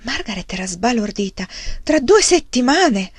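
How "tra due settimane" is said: high, loud and slowed in disbelief; shocked outcry. Acted. Margaret to her father, stunned by the news, shocked loud cry. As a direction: cry out the dates in dismay.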